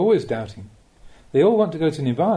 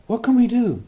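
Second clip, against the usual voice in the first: high, louder mock-helpless plea; helpless followers; 9 semitones higher, 4 dB louder than usual. Teacher imitating busy followers, mock helpless and pleading. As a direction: throw up your hands like a busy lay follower. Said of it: "they ask".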